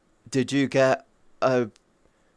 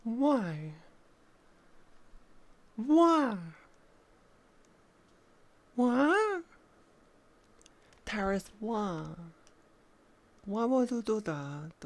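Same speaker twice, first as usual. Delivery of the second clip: rising, higher plaintive whines; mock-hurt whining at a chatter; high whiny why; 7 semitones higher, 5 dB quieter, slower than usual. Real, not acted.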